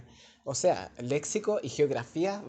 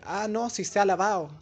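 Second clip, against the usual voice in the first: 1 imitated speaker; 5 semitones higher, 5 dB louder than usual. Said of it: "cuando uno dice".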